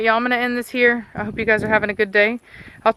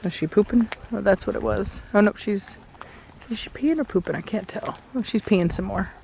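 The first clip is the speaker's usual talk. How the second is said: softer hushed commentary; quiet; 6 dB quieter than usual. Real, not acted. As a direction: murmur while watching closely. Watching dog quietly.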